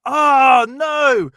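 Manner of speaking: hammed-up dismayed shout; whiny protest. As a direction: refuse like a sulky teen. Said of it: "Onan's like".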